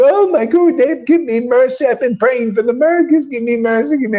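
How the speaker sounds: high, pleading mock plea; whiny begging disciple. Mocking a disciple begging the guru for mercy, high whiny plea. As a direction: ham up a needy follower begging for mercy.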